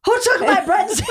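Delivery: laughing mock shout; yelling across the room. Retelling friend stealing breadstick.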